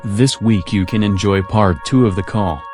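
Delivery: ordinary delivery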